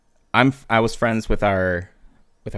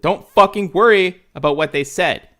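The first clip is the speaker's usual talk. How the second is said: loud, higher-pitched reprimand; irritated bark; 9 semitones higher, 10 dB louder than usual. Acted. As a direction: bark it at a clueless new private. Team leader snapping at new private.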